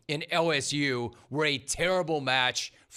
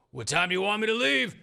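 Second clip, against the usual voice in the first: higher, fast Coach O voice, loud on the door line; Coach O impression; 5 semitones higher, 3 dB louder, faster than usual. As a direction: channel Coach O's folksy bravado. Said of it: "he was probably channeling Coach O".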